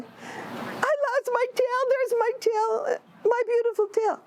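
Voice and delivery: slow, high, sobbing lament; crying rabbit. Acted. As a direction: sob it out, grieving the lost tail. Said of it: "And he started crying".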